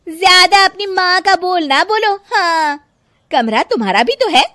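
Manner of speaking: high, loud, indignant wife; angry retort. Acted. Wife snaps back at husband.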